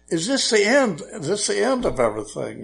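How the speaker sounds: mock fearful child, starting high; scared child. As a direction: ask like an alarmed little boy, lightly acted. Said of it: "the child was scared".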